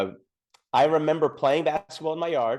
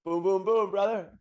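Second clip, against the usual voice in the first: mock street greeting; 8 semitones higher than usual. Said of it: "Can you imagine people say".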